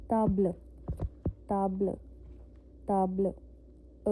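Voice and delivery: "ताब्ल" with silent final e and a short uh; French "ताब्ल" with a faint schwa at the end; French table. Shown as a demonstration. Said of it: "अ की आवाज़ आ रही ना".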